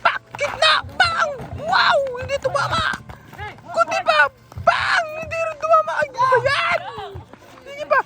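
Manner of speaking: high, loud calls in short bursts; excited play-by-play. Real, not acted. Commentator reacting to near misses, excited shouting. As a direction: shout each near miss as it happens.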